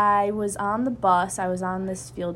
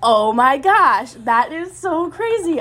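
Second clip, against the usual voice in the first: retold excitement; 6 semitones higher, 8 dB louder, slower than usual. Retelling joy at friends' acceptance.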